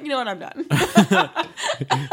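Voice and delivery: laughing, hammed-up quitting line; mock dramatic exit. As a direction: ham up a flouncing exit. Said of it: "I don't think Maud says it that way".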